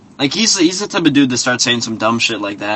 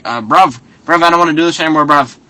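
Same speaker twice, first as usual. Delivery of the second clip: fast, higher, louder London "bruv" whine; mock London accent; 4 semitones higher, 4 dB louder, faster than usual. Fighter tapping out of family game, fast mock London whine. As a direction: rush out the quitting line in a put-on London accent. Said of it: "and he's like".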